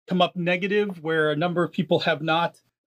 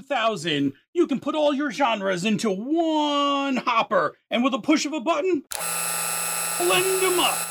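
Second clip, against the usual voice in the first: high, pushy infomercial pitch; hard sell ad; 7 semitones higher than usual. Acted. [name] does a mock infomercial.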